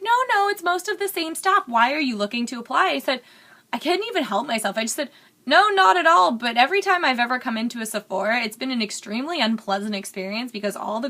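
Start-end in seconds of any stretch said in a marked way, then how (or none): 0.0-2.9 lightly voiced cashier. Checkout girl quoted. 5.4-6.3 emphatic denial. Retold or imitated. Retold blunt denial.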